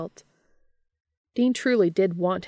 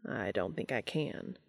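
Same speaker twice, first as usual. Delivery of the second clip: much lower and softer, deadpan; deadpan, quiet and low; low flat angel voice; 8 semitones lower, 9 dB quieter than usual. Acted.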